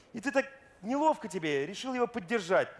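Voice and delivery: normal talking voice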